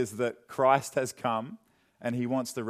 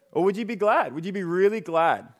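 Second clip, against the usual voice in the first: higher, brighter voiced question; mock glad; 4 semitones higher, 3 dB louder than usual. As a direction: lift it up into mock delight.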